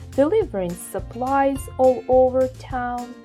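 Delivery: ordinary delivery, nothing marked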